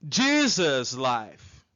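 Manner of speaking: low, drawn-out model answer; careful, stretched-out English answer; slow model pronunciation. Shown as a demonstration. Teacher models the answer slowly.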